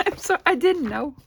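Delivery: laughing. Real, not acted. Laughing at her own joy.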